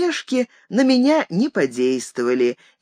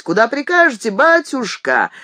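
mild eager cabbie call, slightly raised; крик извозчика; 5 semitones higher than usual. A narrator's reading. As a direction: call out for a fare, a bit higher. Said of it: "принялись кричать". (зазывный выкрик извозчика)

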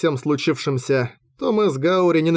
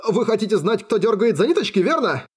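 high, nervous gabble; frightened captive spilling information; jabbering; 7 semitones higher than usual. A narrator's reading. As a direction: rattle it off anxiously. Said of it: "затараторил пленник".

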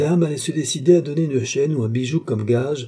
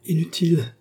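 higher-pitched cry of frustration; vexed exclamation; 7 semitones higher than usual. A narrator's reading. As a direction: exclaim in vexation, giving up. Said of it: "s'écria la jeune femme avec dépit".

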